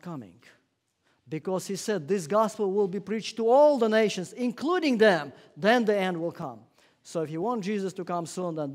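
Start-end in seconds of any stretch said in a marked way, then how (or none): 2.1-6.6 higher, louder declaration of scripture; proclaiming loud. Retold or imitated. Preacher to congregation, quoting Jesus at full force. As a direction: ring out the promise.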